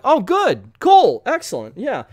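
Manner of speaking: high, loud, eager approval; mock enthusiasm. Reviewer recalling hearing good reviews, mock enthusiasm. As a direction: perk right up, pitched high. Acting out hopeful reaction to reviews.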